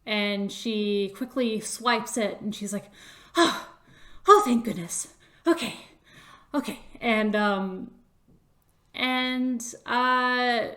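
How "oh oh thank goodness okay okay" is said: breathy-gasp relief, louder at first; relieved. Acted. Chris grabs the amulet off the stage, relieved. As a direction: sigh of relief, then settling.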